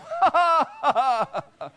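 laughing. Real, not acted. Laughing at his own story.

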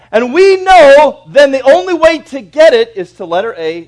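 loud, high, ringing sermon voice; thundering pulpit delivery; full-force preaching. Acted. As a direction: proclaim it to the whole church.

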